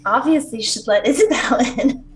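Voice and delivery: lightly hammed-up telling-off; scolding. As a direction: tell it off, like scolding a naughty child. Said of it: "in a very scolding tone".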